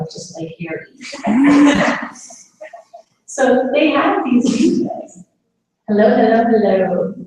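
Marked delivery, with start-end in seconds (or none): 0.0-0.9 softer, breathy murmur; hushed aside. Retold or imitated. Quoting a resident, a soft hushed murmur. 5.9-7.1 louder, calling the room back. Real, not acted. Speaker to the audience, a louder call for attention.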